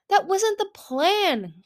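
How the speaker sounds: high, very slow, drawn-out complaint; dismayed objection. A narrator's reading. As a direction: wail it in dismay.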